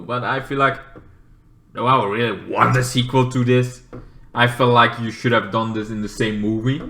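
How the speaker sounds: usual speaking voice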